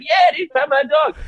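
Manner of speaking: high, loud, excited stranger voice; hyped dog walker. Retold or imitated. Guy on the trail tells him to pet the dog, mock-hyped shout. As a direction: shout it like an eager dog owner.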